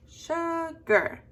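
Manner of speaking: sugar stretched, high SHU dropping on GER; voice up on first syllable. Shown as a demonstration. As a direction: lift and lengthen the SHU in sugar. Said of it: "goes up when you say sh".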